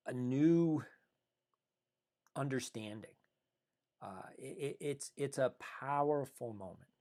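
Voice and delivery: really moved, pausing to keep composure; choked up. Real, not acted. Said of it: "I get choked up".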